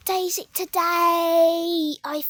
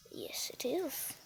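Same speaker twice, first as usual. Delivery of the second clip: hushed whispered reply; very soft, voiceless aside; 2 semitones lower, 17 dB quieter, slower than usual. Pretend. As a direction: whisper the answer slowly. Answers own question in whisper.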